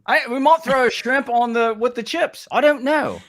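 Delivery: high-pitched mock Cockney; Australian accent slipping into hammed-up Cockney; put-on Cockney accent. Host joking about shrimp and chips in a bad Cockney accent. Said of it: "now I'm going Cockney on you".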